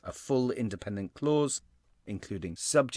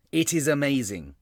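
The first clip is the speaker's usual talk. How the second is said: raised, louder voice on a short exclamation; emphatic exclamation; 4 semitones higher, 5 dB louder than usual. Shown as a demonstration. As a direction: punch it out for the readers. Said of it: "I'm really shouting at my readers".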